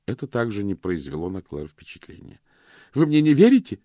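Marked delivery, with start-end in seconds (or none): 2.9-3.8 high, loud gasping question; breathless. Acted. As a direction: gasp it out in disbelief. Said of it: "задыхаясь".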